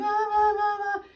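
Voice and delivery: high loud wailing ah sounds; imitated baby crying. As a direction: mimic a crying newborn. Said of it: "if the baby starts crying".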